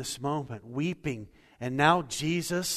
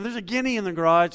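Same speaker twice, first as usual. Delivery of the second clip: higher, louder, quick call into the house; re-enacted shout, raised and fast; retold holler; 6 semitones higher, 8 dB louder, faster than usual. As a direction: holler it back indoors, retelling it. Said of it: "so I hollered back in".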